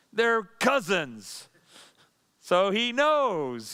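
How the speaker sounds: drawn out, slow and pointed; slow knowing emphasis. Real, not acted. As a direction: let each word land slowly and deliberately.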